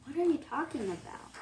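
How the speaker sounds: soft, quick piggy protest; quick quoted protest. Acted. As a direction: rush it out quietly. Voicing Piggy's thrashing protest.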